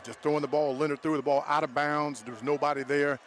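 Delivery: ordinary talk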